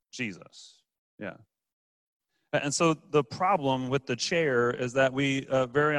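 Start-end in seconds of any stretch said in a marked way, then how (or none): 0.1-1.3 quiet reverent reveal; hushed answer. Real, not acted. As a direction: lower and much softer, a reverent single word. Answer to his own question.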